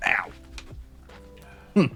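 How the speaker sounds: ordinary talk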